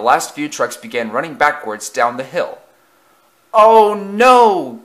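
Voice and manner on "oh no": high, loud, drawn-out cry; alarmed cry. A narrator's reading. Engine sees his trucks break loose, alarmed cry. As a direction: cry out in sudden horror. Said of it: "cried eight seven five four six".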